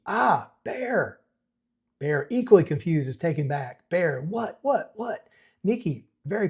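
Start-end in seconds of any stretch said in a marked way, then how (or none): 0.1-1.2 startled yell of her name; shouted in all caps. Acted. Nikki waking in shock, yelling Bear's name in all caps. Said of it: "All caps". 3.9-5.3 rising, bewildered repeats; confused. Acted. Bear reeling after Nikki's kiss, asking what in confused disbelief.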